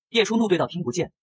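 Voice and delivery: plain reading voice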